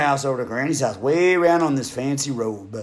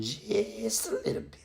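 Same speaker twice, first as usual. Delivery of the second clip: words stretched high like a creaky hinge; creaking door imitation; 8 semitones higher, 11 dB quieter than usual. A narrator's reading. Storyteller opening Granny's door, creaky and high. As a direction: squeeze it upward like a door easing open.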